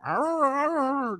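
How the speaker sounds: high, far-off mock "ah, ah" moans; imitating wordless pleading noises. Imitating the woman begging at the counter with high wordless noises. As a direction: mimic a woman grunting for money.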